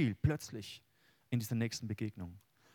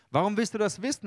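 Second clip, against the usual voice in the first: much higher, louder counter-question; the stranger answering Jakob, raised and pointed; challenging retort; 9 semitones higher, 8 dB louder than usual. Acted. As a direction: throw the question back sharply.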